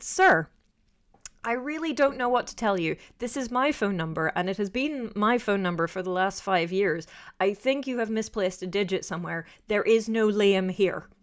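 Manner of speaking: emphatic, exasperated phone reply, acted out; retold firm insistence. Student telling Liam's dad it's a wrong number, acted firmness. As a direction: spell it out firmly to a mistaken caller.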